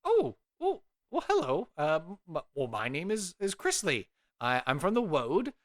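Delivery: startled high greeting, then settling; flustered young scholar. Acted. Young traveler introduces herself to the old druid, flustered.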